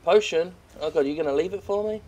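ordinary delivery

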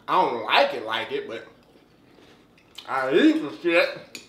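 chewing between words; talking while eating; mouth full of cereal. Real, not acted. Seen 1: Spoon to mouth repeatedly, bowl in hand, chewing while talking.

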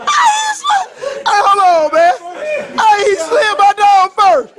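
shouting, high and slow; laughing outrage. Real, not acted. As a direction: yell in mock protest. Mock outrage after slide.

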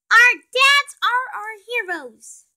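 a hint of slow, high kid chirp; lightly voiced happy child; cheerful kid voice.

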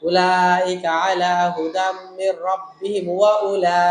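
slow recitation breaking off right after ওয়া উলা, mid-word; slow, soft recitation cut off mid-word; wrong pause mid-word. Shown as a demonstration. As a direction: stop dead inside the word at উলা. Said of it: "আমি ওয়া উলা বলে অক্ষ করলাম".

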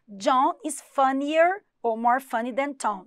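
careful teaching pace; slow model sentence. Shown as a demonstration. Teacher to students, slow model reading. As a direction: read both forms slowly and clearly.

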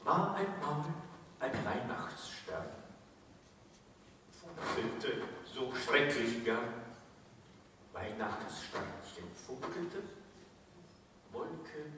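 hushed mock-solemn Christmas poem; comic poem recital. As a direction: let each short phrase drop softly, with long pauses.

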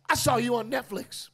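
put-on high, loud child's shout; excited kid. Kid at school shouts at the comedian, high and loud. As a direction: call it out like a thrilled little boy.

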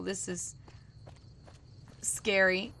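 ordinary talk, nothing marked

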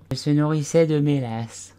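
a dozy little animal insisting it was awake; sleepy Dormouse. A narrator's reading. Dormouse defending himself to the tea party, drowsy and high. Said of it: "après deux minutes de réflexion".

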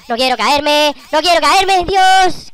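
high loud panic; panicked shout. Real, not acted. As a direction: yell in terror of falling.

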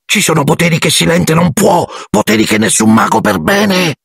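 high, urgent squeaky warning; agitated hush, pitched right up; agitated elf. A narrator's reading. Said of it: "divenne un sussurro concitato". (elfo concitato, voce acutissima)

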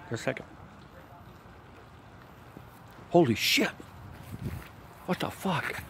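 high, hushed pretend fright; fake panic. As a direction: act spooked by something unseen. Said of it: "Ah, I'm just playing".

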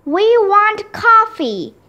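high, insistent protest cry; high-pitched protest yell; yelled demand. A narrator's reading. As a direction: yell it like a picket-line slogan. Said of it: "yelled Mister Klutz".

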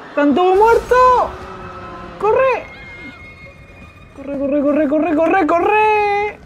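high frantic shouts; high-pitched panicked cries; terrified yelling. Real, not acted. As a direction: scream at yourself to run. Fleeing monster, screaming to run.

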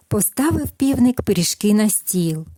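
gentle storyteller voice, lively at the quotes; reading a fairy tale to children. Acted. Narrator reads the tale to toddlers, gentle storytelling. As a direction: tell it like a bedtime tale for little ones.

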